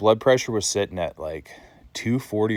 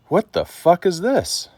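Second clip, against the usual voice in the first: alarmed disbelief; 10 semitones higher, 4 dB louder, slower than usual. Retold or imitated. Sudden racing heart at midnight.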